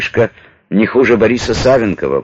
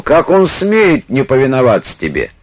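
high, raised outraged exclamation; outraged shout; 7 semitones higher than usual. Acted. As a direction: shout it in indignation, higher pitch. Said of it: "Распутин ударил кулаком по столу и вскричал". (возмущённый выкрик)

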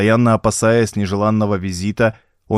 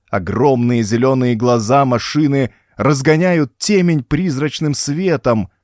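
ordinary delivery

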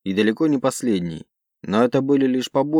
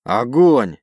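loud barked command; barked order; 6 dB louder than usual. Acted. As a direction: bark the fire order. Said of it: "рявкнул командир".

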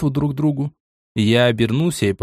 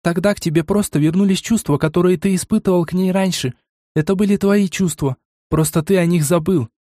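высокий девичий голос, горячо; с жаром убеждает жениха; 7 semitones higher than usual. A narrator's reading. Элири убеждает героя. (высокий голос, горячо убеждая)

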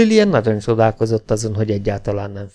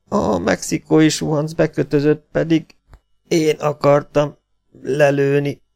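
wounded half-breed; 5 semitones higher than usual. Acted. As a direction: drag each word out in pain. (magas, lassú, tört beszédű sebesült)

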